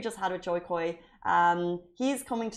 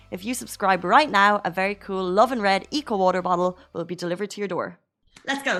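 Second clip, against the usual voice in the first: upbeat louder promo voice; punchy sponsor announcement; ad read; 6 dB louder than usual. Acted. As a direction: sell it like a radio spot. Ad read for membership.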